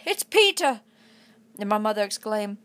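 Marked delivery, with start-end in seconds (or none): 0.0-0.8 high, loud cry of recognition; overjoyed exclamation at the door; excited cry. A narrator's reading. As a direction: call out his name in sudden relief. Sister cries out seeing brother return.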